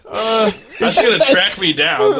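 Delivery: high laughing words, slow; joking about being tracked down, laughing. Real, not acted. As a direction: laugh through the line.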